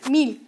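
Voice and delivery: "mil" said cleanly as Spanish "meel"; "mil" modeled slowly for learners; model word. Shown as a demonstration. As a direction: give "mil" for the class to repeat.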